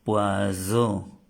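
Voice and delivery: "poisson" said with a z sound, "pwazo"; wrong pronunciation. Shown as a demonstration. Teacher showing students the wrong sound, buzzed z. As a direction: buzz the middle of "poisson" like a z. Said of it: "no estamos diciendo poisson".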